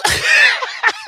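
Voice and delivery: squealing laugh; high shrieking laugh. Real, not acted. Laughing after catchphrase.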